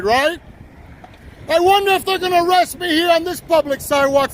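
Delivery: angry heckling. Real, not acted. Man goading transit police to arrest him, heckling angrily. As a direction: needle and insult them, pushing for a reaction.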